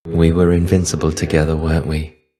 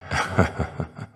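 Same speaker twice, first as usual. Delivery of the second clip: a whispered chuckle; little laugh; 2 semitones lower, 5 dB quieter than usual. Acted. Shade teasing the stranger whose fish got away, whispered chuckle. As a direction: laugh under the breath, teasing.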